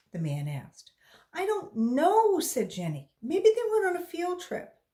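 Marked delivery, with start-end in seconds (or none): 1.3-4.8 put-on high, loud anxious girl voice; a scared child pleading; frightened girl. A narrator's reading. Frightened girl answers stranger.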